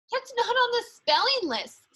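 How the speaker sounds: put-on high, protesting kids' voices; a class of kids complaining; kids muttering. A narrator's reading. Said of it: "several kids muttered".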